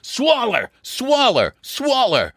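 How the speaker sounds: mild narrated holler. A narrator's reading. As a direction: order her to swallow. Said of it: "I fairly hollered at her".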